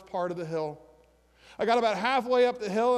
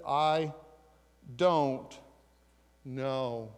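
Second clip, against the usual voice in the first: slow, fading, much lower "I... don't... know"; slow bewildered; 7 semitones lower, much slower than usual. Acted. Healed man answering the Pharisees, slow and lost.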